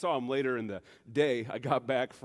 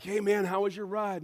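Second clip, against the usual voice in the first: high, louder chummy call; pitched-up, casual driveway hello; friendly neighbor greeting; 9 semitones higher, 5 dB louder than usual. Retold or imitated. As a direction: imitate a neighbor hailing a friend.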